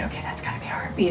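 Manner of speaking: quick, breathless whisper to herself; hopeful whisper. Retold or imitated. Watching the ultrasound screen, a hopeful whisper. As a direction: whisper it fast, barely daring to hope.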